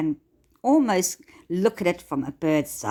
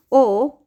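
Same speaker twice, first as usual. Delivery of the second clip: a high, loud, drawn-out or; emphatic or; 7 semitones higher, 7 dB louder than usual. Real, not acted. Coach to listener, choosing the other path: emphatic or. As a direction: lean hard on or to flip the choice. Dramatic turn to the better choice.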